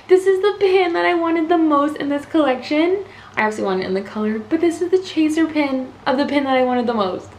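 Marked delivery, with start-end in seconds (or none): 0.1-3.0 excited gushing. Real, not acted. Gushing over chaser pin.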